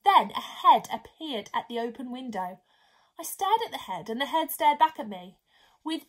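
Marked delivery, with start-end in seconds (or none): none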